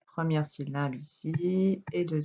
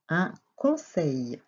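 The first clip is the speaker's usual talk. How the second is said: "Un conseil" very slow, split into pieces; slow dictation; much slower than usual. Shown as a demonstration. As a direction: dictate "Un conseil" with clear gaps. Teacher dictates the word slowly.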